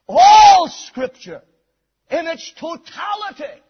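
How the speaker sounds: high, ringing pulpit proclamation; full-force preaching. Real, not acted. Preacher declaring Scripture is God-breathed, full-force preaching. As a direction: preach it out with weight on the key words.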